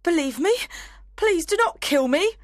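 very high, loud, fast pleading; begging for life. Acted. Nancy begging Sykes not to kill her, frantic. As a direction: beg desperately, rushing the words.

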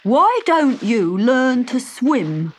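slow, teasing creature voice; frog character. Acted. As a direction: drawl it out like a storybook frog. Frog character advises the witch.

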